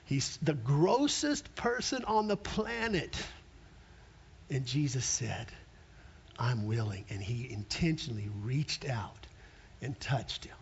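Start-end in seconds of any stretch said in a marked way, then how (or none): 4.5-10.6 tender. Acted. Tender climax.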